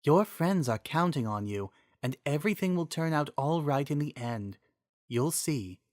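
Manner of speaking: a wise spirit speaking kindly; the Triforce of Wisdom thanking Miranda, gentle and soft; soft female voice. Acted.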